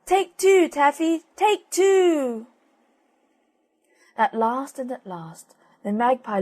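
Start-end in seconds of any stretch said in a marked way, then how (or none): 0.0-2.5 very high, loud cooing call; silly dove cry. Acted. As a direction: cry it out shrill and insistent like a dove. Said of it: "Still the turtle dove cried".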